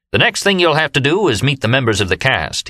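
official tones. A narrator's reading. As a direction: sound like a self-important official. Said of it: "in his official tones".

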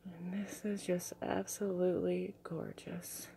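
slow, awed whisper; soft slow whisper of admiration. Real, not acted. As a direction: hush it slowly like a secret. Whispering while showing the crystal.

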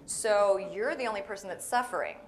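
ordinary delivery

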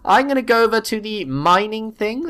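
usual speaking voice

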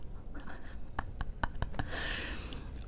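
hushed laughing fit; whispered laughter. Real, not acted. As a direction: breath-only giggle. Cracking up at own put-on voice.

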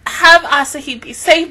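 usual speaking voice

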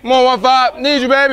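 high, loud rally call; cheering. Real, not acted. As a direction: pump up the hitter. Cheering from dugout.

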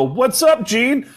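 high, loud mock greeting. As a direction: chirp it up high like a buddy. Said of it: "And he'll go".

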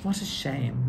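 plain reading voice

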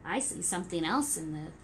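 gentle rhyming storybook read; reading to children. Acted. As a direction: read it like story time for toddlers. Reading rhyming picture book.

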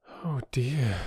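resigned little sigh. A narrator's reading. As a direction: sigh the words out in dismay. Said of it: "said Bobby with a sigh".

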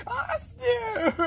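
high tearful wail of a name; sobbing call. Acted. Calling a friend mid-breakdown, sobbing wail.

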